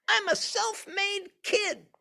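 put-on very high, squeaky little-kid voice; tiny boastful child voice; conceited toddler. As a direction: play a bragging two-year-old. Conceited two-year-old voiced.

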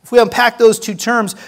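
ordinary delivery

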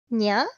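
high rising meow-like yeah; cat imitation. Shown as a demonstration. Friend demonstrates a cat's sound, high rising imitation. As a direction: mimic a talking cat. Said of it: "For those who don't know what cats sound like, it's like".

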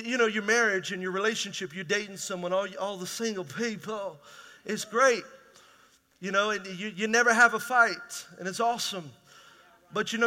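ordinary delivery

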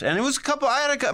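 ordinary delivery

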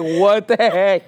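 high, loud and shocked. Retold or imitated. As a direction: act out a startled reaction. Mock reaction to cussing phone.

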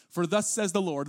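solemn, weighty read of God's own words; scripture reading. Acted. Pastor reading Isaiah aloud to the congregation, solemn and weighty.

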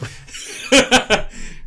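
airy burst of laughter; breathy high laugh. Real, not acted. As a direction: laugh without voice. Laugh before impression.

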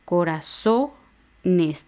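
Corazones split with a pause before the final NES; word broken into syllables. Shown as a demonstration. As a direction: hold SO, then add NES in Corazones. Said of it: "son cuatro sílabas".

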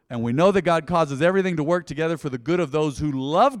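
loud, driving verse reading; scripture proclamation. Real, not acted. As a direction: declare the verse forcefully to the congregation.

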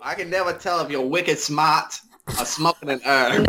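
put-on a light Boston accent on the tweet; mild put-on Boston accent. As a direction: read it like a Bostonian, wicked smart. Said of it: "that accent needs to go in the closet".

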